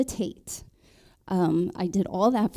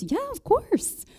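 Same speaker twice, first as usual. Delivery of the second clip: mother-in-law voice; 13 semitones higher, slower than usual. Retold or imitated.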